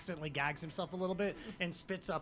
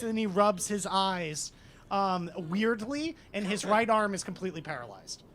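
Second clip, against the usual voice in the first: ordinary talk, nothing marked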